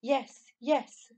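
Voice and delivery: put-on high, loud, eager boy; crying out. A narrator's reading. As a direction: cry it out eagerly. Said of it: "cried Barney".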